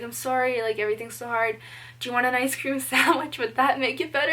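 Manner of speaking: a hint of a soothing mom offering a treat; sweet mom voice. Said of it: "she's like".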